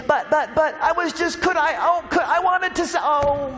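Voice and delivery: high, rushed stammering protest; flustered interrupting plea. Retold or imitated. God calling after praying people, high flustered plea. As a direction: try to get a word in as they leave. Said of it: "God is saying".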